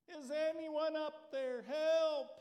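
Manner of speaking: high, loud cry for help; a man hanging from a cliff yells for rescue; yelling for help. Acted. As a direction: shout it up the cliff face, desperate. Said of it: "He yelled for hours".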